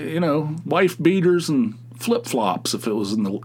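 usual speaking voice

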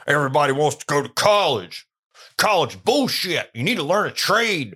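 high, loud mocking blowhard impression; mock a preachy tradesman ranting loudly; Mike Rowe. Host mocking Mike Rowe on college, loud scornful impression. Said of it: "not Mike Rowe".

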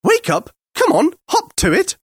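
very high, impatient creature voice; high-pitched cross Martian order; irritable alien. A narrator's reading. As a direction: chivvy him sharply, pitched right up. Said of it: "irritably at him and said".

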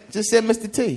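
ordinary talk

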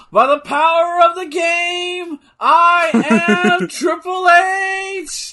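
high, loud, drawn-out heroic shout; mock battle cry. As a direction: bellow it like a cartoon hero transforming.

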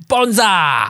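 "Bonza!" in a loud, high, hammed-up Australian accent; mock Australian. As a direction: shout "Bonza!" like a cartoon Aussie. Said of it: "So he just sounded Australian".

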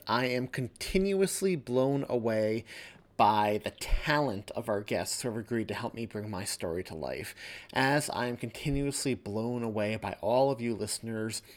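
plain reading voice

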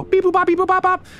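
high-pitched phone beeps; imitated dial tones. Mayor dials an extension, imitated dial tones. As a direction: make high little keypad noises with the voice.

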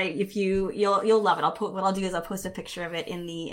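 normal talking voice

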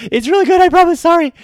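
high, loud joking protest; excited protest. Real, not acted. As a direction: shout it, laughing off the joke. Joking apology after self-mocking example.

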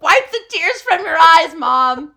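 loud, slow, high mock-kid order; hammed-up exasperated kid, laughing underneath; bossy child. Child to crying mom, loud bossy mock demand.

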